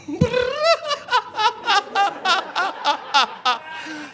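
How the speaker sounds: high, long delighted laugh; high cackling laugh of delight; friend's big laugh. Retold or imitated. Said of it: "he was like".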